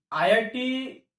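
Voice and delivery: ordinary talk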